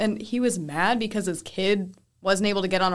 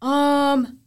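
higher, louder, blank-faced reply; mock clueless answer; 6 semitones higher, 8 dB louder, much slower than usual. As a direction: answer like you missed the question. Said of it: "they're just like".

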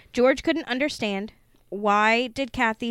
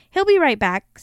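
higher, louder, lightly voiced child; little girl; 6 semitones higher, 7 dB louder than usual. A narrator's reading. Little girl telling her father about her friend, child voice. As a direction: answer brightly as a matter-of-fact child. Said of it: "said the little girl".

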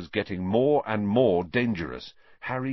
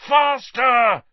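high, loud shout urging on; urgent shout; 13 semitones higher, 9 dB louder than usual. A narrator's reading. Harry urging his broom toward the snitch, fierce shout. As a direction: yell at the broom to go faster. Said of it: "he growled at his nimbus".

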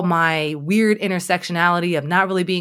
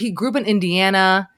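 ordinary delivery

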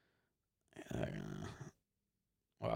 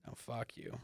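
dismissive; 18 dB quieter than usual. Retold or imitated. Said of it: "They're like".

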